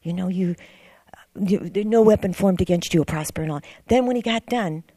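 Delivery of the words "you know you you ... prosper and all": louder, fervent blessing; quoting scripture blessing. Retold or imitated. Stranger blessing the speaker, fervent and louder. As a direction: bless her like a preacher. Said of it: "started quoting all the scriptures".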